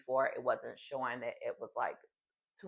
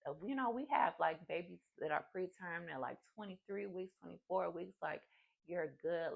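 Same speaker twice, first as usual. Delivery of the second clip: high, soft reassurance, imitating a nurse; gentle pitched-up nurse voice; calming nurse; 5 semitones higher, 4 dB quieter than usual. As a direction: play the nurse soothing a worried patient. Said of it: "calmed me down".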